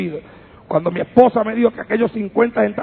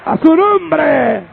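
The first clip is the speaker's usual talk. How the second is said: high, long-drawn pulpit shout; rousing shout; 8 semitones higher, 6 dB louder, much slower than usual. Real, not acted. Preacher calling the congregation to praise, shouting. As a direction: stretch it out as a high call to the crowd.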